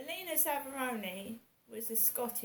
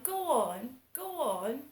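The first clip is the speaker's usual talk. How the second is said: mock posh voice; 4 dB louder, slower than usual. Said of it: "And it sounds posh".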